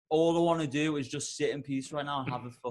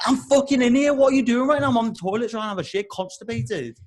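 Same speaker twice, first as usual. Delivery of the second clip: loud, high, rushed protest; indignant yell; 5 semitones higher, 4 dB louder than usual. Retold or imitated. Man on the toilet to the door-banger, indignant yelling. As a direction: shout back through a closed door.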